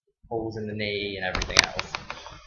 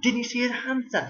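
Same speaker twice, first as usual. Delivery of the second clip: much higher, imitating an eager kid; mock schoolkid, pitched way up and a bit louder; excited pupil; 5 dB louder than usual. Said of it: "someone came around and went".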